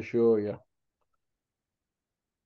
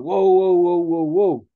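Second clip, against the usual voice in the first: loud, higher whoa calls cutting in; retold alarmed stop; 3 semitones higher, 8 dB louder, slower than usual. Host halting a guest live on air, loud whoa. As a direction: shut the accusation down with loud whoas.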